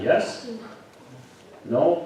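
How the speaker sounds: ordinary talk, nothing marked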